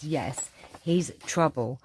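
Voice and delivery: normal talking voice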